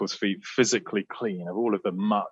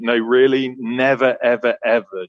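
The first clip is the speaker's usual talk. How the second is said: emphatic insistence; 5 dB louder than usual. Retold or imitated. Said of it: "very emphatically".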